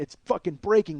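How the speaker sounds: usual speaking voice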